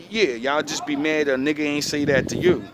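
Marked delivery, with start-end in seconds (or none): none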